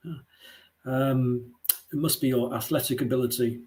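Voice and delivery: ordinary talk, nothing marked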